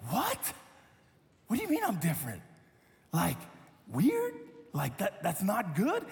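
a squeaky rising What? then worried questions; playing his younger self, puzzled and a bit hurt; startled disbelief. Retelling the student's remark, acting out anxious disbelief. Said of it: "And I was like".

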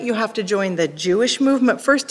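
normal talking voice

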